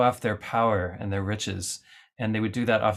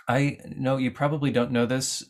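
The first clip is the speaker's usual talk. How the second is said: normal talking voice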